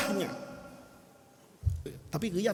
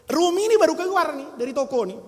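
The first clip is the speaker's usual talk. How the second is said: usual speaking voice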